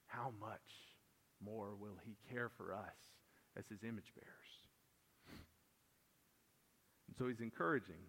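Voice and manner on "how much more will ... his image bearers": hushed wonder. Real, not acted. Pastor to the congregation, hushed and tender. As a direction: drop to a near whisper, slowing at the end.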